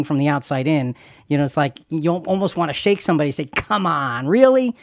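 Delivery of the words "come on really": exasperated. Acted. Exasperated appeal to somebody.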